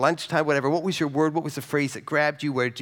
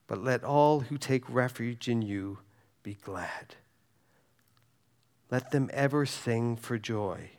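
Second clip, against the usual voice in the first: prayerful psalm reading; 3 semitones lower, 4 dB quieter than usual. Acted. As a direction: read it softly as a prayer to God.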